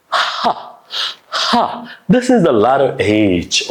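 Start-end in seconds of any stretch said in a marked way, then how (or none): 0.1-2.0 ha ha pushed from the belly; breathy h demo. Shown as a demonstration. Teacher demonstrating h for the class. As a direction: force air out on each ha. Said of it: "Okay, do it".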